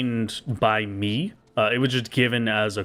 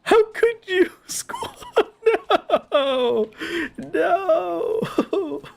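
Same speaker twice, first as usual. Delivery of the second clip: mock grief; much slower than usual. Real, not acted. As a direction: wail over a lost pet, laughing. Mourning the killed monster.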